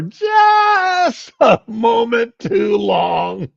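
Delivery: drawn-out words broken by real laughter; laughing. Real, not acted. Husband cracking up telling the wife story, laughing. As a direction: laugh through the end of the line. Laughing at his own punchline.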